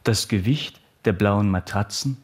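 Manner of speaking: usual speaking voice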